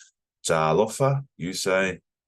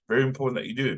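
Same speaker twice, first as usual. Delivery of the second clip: normal talking voice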